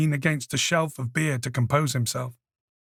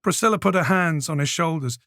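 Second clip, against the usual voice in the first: usual speaking voice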